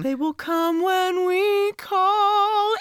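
slowly, with awe; hushed reverence, drawn out; slow, reverent quote. Retold or imitated. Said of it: "full body chills".

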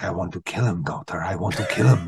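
low, quiet, menacing vow to kill; a low, murmured murder threat to the therapist; menacing killer. Retold or imitated. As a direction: growl it low, like a man coming unhinged. Dreyfuss voiced vowing murder.

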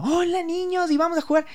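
high, sugary party-host voice; mock kids' entertainer. Party host greeting children, mocked in high sugary voice. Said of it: "había una chica que era como".

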